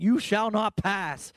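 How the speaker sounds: mock-epic wizard line, each word drawn out; Gandalf. Preacher likens Paul facing death to a wizard, mock-epic defiance. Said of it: "he literally is like Gandalf going".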